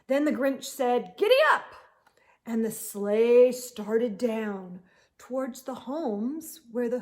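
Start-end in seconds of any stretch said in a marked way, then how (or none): 1.2-1.7 high, loud call. Acted. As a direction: shout it to the dog. Grinch drives sleigh.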